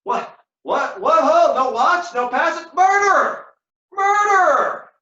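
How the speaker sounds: high, loud shout of alarm; crying out. Acted. Wounded Cassio calls from the ground for the watch, high shouting. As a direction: yell murder into the empty night.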